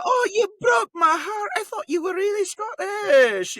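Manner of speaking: very high, slow, loud Scottish wail; hammed-up betrayed shriek, words drawn out; heartbroken Scottish mother. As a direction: wail in mock heartbreak as the wee Scottish mum. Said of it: "a four-foot-tall Scottish lady".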